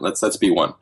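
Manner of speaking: normal talking voice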